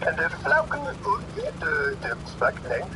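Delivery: put-on gibberish Norwegian accent with English words popping out; singsong fake Norwegian patter; mock Norwegian comic. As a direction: imitate a Norwegian stand-up routine. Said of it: "went like this".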